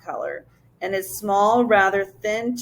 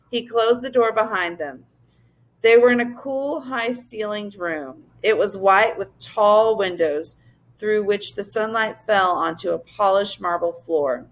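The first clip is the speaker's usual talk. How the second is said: ordinary delivery, nothing marked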